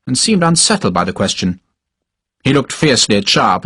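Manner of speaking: usual speaking voice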